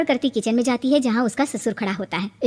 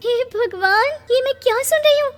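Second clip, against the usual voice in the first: wailing; 10 semitones higher than usual. Acted. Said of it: "अब ये रोना धोना बाद में करना".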